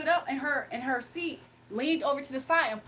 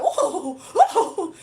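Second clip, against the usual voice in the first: loud laughing whoa, pitch climbing; goofy laugh; 5 semitones higher, 10 dB louder than usual. Retold or imitated. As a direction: imitate a class clown's goofy laugh. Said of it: "That's how he used to laugh".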